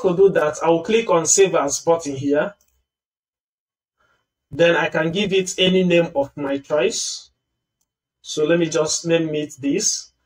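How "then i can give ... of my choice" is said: ordinary delivery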